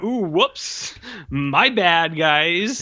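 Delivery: high, drawn-out comic apology; mock sheepish oops. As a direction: play the bumbling cop owning up. Cop's gun goes off by accident.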